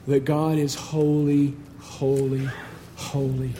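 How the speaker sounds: slow, low and soft, fading with each repeat; hushed reverence. Real, not acted. Hushed climax after louder contrasting lists.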